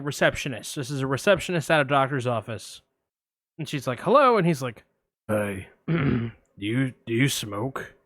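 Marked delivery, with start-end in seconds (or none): 4.0-4.4 higher, louder phone hello; chirpy receptionist pick-up; receptionist's bright answer, pitched up. Retold or imitated. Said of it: "I'm gonna play both parts". 5.3-7.9 lurk low like a shady caller; a hint of Buffalo Bill, low and slow; creepy caller. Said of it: "I'm gonna play both parts".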